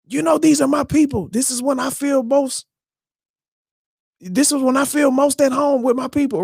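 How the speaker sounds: high, lightly voiced woman; quoting the girlfriend. A girlfriend in the show explaining herself, lightly voiced. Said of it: "she's like".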